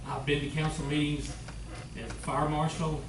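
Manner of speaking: usual speaking voice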